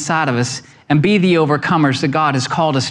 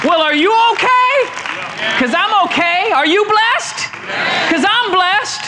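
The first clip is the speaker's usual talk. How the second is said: preaching at full force; 12 semitones higher than usual. Real, not acted. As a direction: lift the room, preach it out. Preaching climax to congregation.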